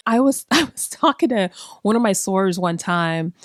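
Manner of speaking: normal talking voice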